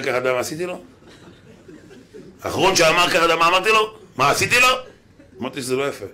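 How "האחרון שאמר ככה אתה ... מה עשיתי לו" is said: louder, higher, rising indignant questions; mock-menacing tirade, pitched up and loud; escalated threatening boast. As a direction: hurl the questions out as a raised-voice threat. Same boast repeated, escalated.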